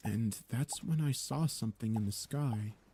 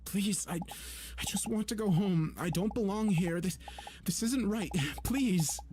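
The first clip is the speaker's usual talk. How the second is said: cracking desperate plea; desperate pleading; 9 semitones higher than usual. A narrator's reading. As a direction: beg to go home. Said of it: "I croaked".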